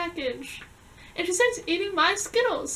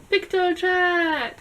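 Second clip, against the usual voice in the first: mock announcer voice; 3 semitones higher, 7 dB louder, much slower than usual. Naming the DS chat app.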